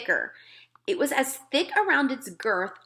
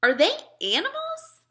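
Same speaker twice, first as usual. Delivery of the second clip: put-on higher, puzzled girl's voice; a small girl asking politely; higher child voice; little girl Sophie; 13 semitones higher than usual.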